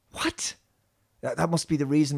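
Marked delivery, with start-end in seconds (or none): 0.1-0.5 whispered baffled what; incredulous whisper. Real, not acted. Reviewer reacting to the cloaking excuse, whispered. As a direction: breathe it out in disbelief. Baffled aside at plot hole.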